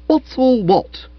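high, slow, cheeky retort; spirited retort. Acted. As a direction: throw his words back at him. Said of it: "riposted Stiffy with spirit".

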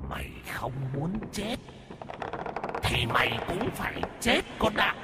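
soft, high, breathy ghostly hiss. Acted. Ghost hissing at the doomed man, menacing hiss. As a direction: hiss it like a malevolent spirit. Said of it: "mà rít lên".

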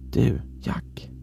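sudden hushed whisper. A narrator's reading. Voice whispering to Jack through cracks in the door, whispered. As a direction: whisper it from behind someone's back. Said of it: "hörde jag plötsligt en viskning".

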